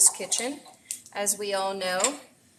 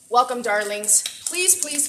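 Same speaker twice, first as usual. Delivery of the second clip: normal talking voice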